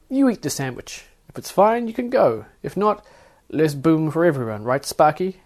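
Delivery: high, taunting terms; smug guard. Acted. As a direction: Carlene taunts the passenger to eat the sandwich, smug and high. Carlene gives terms with a grin.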